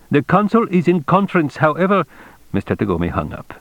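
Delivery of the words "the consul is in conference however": high-pitched, officious clerk; accented consulate voice, pitched well up; accented functionary. A narrator's reading. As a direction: fob the caller off as a brisk underling. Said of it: "At last the functionary's voice once again".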